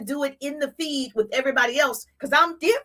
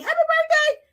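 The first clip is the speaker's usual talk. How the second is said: generic greeting. Shown as a demonstration. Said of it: "just a generic saying".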